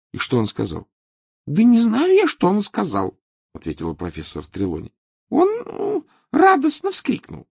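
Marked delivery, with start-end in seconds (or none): 1.5-3.2 Trelawney voice. Acted. 5.3-7.5 Trelawney voice. Acted.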